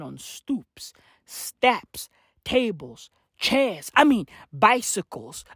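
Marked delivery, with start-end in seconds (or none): none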